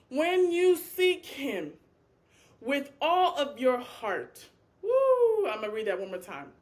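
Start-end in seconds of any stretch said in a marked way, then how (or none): 0.1-4.5 emotional reading. Real, not acted. Preacher overcome, reading scripture. 4.8-5.5 high, loud woo; loud high woo of release; excited whoop. Real, not acted. Preacher's exclamation after emotion.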